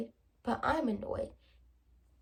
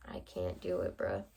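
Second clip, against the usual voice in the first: much lower, softer, deadpan refusal; a low, quiet bruh of refusal; deep dismissive; 6 dB quieter than usual. Real, not acted. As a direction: drop the voice low and flat-out refuse. Hat dealbreaker.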